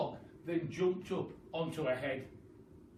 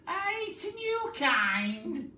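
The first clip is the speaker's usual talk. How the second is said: much higher, loud, very slow sweet-talk; loud honeyed old hag voice; sly Missus Twit; 8 dB louder, much slower than usual. Acted. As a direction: coax him with fake sweetness. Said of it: "Missus Twit said".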